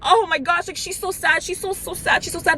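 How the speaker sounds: high, cooing mock sympathy; consoling. Relatives running to console a crying girl, high mock-pitying voice. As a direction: imitate relatives fussing over a sobbing girl. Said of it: "console me".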